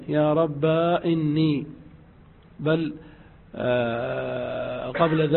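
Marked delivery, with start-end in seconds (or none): 0.1-1.7 "رب" sung out ending in a long a; ربا with alif. Shown as a demonstration. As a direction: stretch "رب" into "ربا". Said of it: "قلبها ألفا". ("رب" بقلب الياء ألفا)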